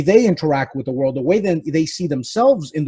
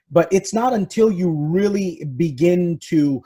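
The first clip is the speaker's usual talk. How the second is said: ordinary delivery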